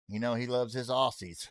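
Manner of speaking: usual speaking voice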